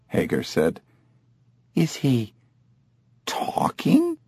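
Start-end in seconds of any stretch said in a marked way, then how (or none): 1.8-4.2 soft voice leaping high at the end; halting, incredulous question; hushed disbelief. A narrator's reading. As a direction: ask in astonished near-whisper. Amazed tourist asks about ape.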